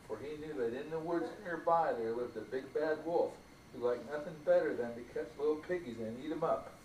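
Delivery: ordinary delivery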